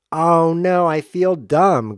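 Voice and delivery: loud, high comic lament; hammed-up "oh no"; mock dismay. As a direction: wail it in pretend embarrassment. Mock dismay at own mistake.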